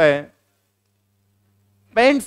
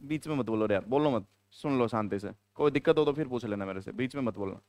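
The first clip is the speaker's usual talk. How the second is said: quiet aside; 11 semitones lower, 13 dB quieter than usual. Real, not acted. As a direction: shush the interrupters under the breath. Teacher hushes interrupting students. (बच्चों को धीमी आवाज़ में डाँटते हुए)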